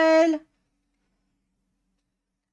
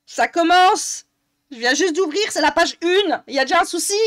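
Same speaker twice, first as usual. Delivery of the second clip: louder, higher, fed-up ranting; irritated raised voice; loud annoyed complaint; 6 semitones higher, 9 dB louder than usual. Real, not acted. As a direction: complain loudly at the page. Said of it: "je commence à gueuler".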